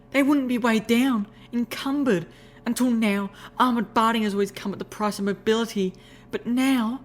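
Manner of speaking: high, excited lady's voice; rapturous. Acted. Rarity selling her idea to Applejack, high rapturous voice. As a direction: gush with excitement, pitched high. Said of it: "glowing in the rapture".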